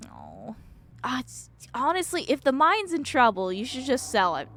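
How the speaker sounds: ordinary talk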